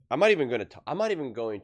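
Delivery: a sudden loud fed-up start; exasperated outburst. Real, not acted. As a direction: blurt it out, fed up, then stop short. Exasperated host reacting to clip.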